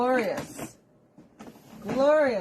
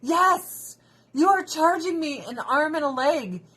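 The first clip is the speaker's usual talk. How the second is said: high, loud outraged yes to more bread; indignant outburst; 6 semitones higher, 5 dB louder than usual. Retold or imitated. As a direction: blurt it out, outraged they'd even ask. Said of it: "I'm like".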